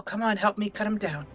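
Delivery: ordinary delivery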